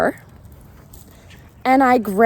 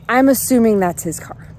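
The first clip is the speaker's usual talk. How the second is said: ordinary delivery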